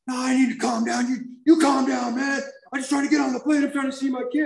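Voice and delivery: retold frantic yelling. Passenger pleading to get on the plane, high retold shout.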